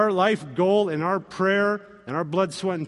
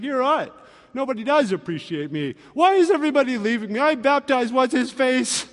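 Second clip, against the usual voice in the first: whiny self-pity; 6 semitones higher than usual. Pretend. John's imagined pity party.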